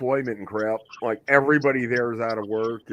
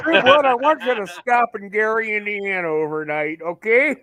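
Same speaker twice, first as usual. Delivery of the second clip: higher, louder bossy order; mock boss; 7 semitones higher, 5 dB louder than usual. Voicing the trucker's boss, higher louder mock order. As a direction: play the boss with fake cheer.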